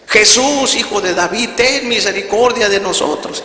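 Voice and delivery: loud, high-pitched plea; grito de súplica. Retold or imitated. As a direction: cry out together on the count of three. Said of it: "Y empezaron a gritar". (grito agudo de súplica)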